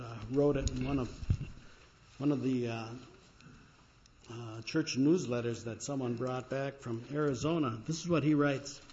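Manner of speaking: normal talking voice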